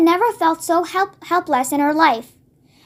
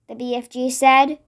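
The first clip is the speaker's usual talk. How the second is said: plain reading voice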